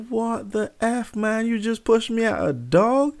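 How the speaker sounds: high-pitched, incredulous protest; retold freak-out. Son pushed at a dog by dad, mock freaking out. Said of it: "And I'm freaking out".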